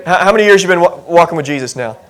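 usual speaking voice

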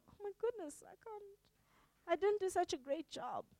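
hurt Secret Santa, barely voiced; crestfallen. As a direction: deflate almost to nothing. Giver hurt, self-doubting.

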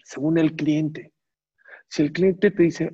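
ordinary delivery

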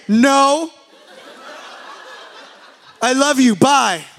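loud hurried goodbye. Retold or imitated. Brushing off his worried wife, loud and laughing. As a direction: cut her off loud and laughing.

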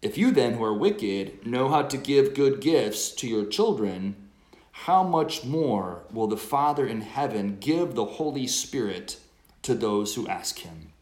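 loud, measured scripture reading; gospel proclamation. Acted.

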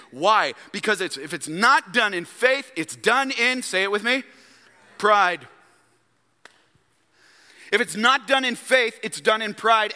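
impassioned preaching. Real, not acted. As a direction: preach it high and hard, leaning on key phrases. Preaches with raised force.

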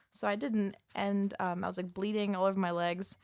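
normal talking voice

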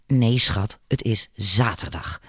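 the boss mocking the confused worker, sneering. A narrator's reading. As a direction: talk down to her like a fool. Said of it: "zei Palmer smalend".

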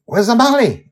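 high, urgent shout; shouted question. A narrator's reading. Grandpa Walt looks for Amali on deck, shouting. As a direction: shout out for someone missing. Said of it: "I shout".

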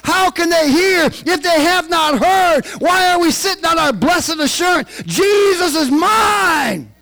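high, loud, slow preacher's shout; preaching at full force. Acted. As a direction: bring the gospel home at full volume. Preaching climax.

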